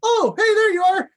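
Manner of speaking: high and loud delight; surprised happy greeting; excited. Pretend. Found buddy in game.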